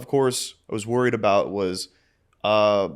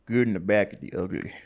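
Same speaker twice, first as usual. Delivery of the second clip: cowboy voice; 3 semitones lower than usual. Shown as a demonstration. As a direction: say it like a Western cowboy. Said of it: "That was my cowboy voice".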